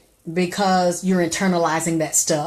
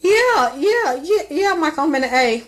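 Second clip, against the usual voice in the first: high, loud mock yeah; high, loud mimicry of a flip answer; imitating reply; 9 semitones higher, 5 dB louder than usual. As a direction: squeal it back, high. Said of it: "She sat in my face and said".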